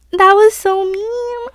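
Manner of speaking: high, loud and slow outrage; indignant squeal. Real, not acted. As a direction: pitch it way up in mock outrage. Reacting to boyfriend's cruel joke.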